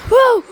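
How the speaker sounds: real high, loud shriek of fright; sudden loud startled yelp; startled scream. Real, not acted. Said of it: "I just screamed at her".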